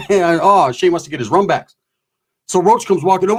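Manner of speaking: ordinary talk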